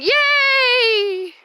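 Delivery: high, loud happy yay; excited cheer. Real, not acted. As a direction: cheer it out with delight. Celebrating the working camera.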